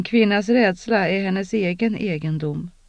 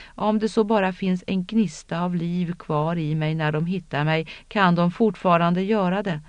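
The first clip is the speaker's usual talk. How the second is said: ordinary delivery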